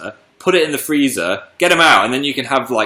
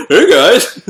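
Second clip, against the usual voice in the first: excited greeting; 8 semitones higher, 6 dB louder than usual. Retold or imitated. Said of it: "I was just like".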